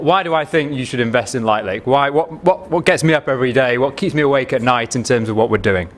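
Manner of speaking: ordinary delivery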